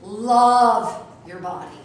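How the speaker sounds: Love your body stretched very slowly and loud; emphatic exhortation. Shown as a demonstration. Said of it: "repeated exhortation".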